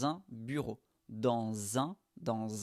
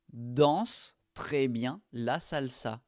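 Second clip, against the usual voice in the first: slow dictation; slower than usual. Shown as a demonstration. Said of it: "Comment allons-nous dire cette phrase".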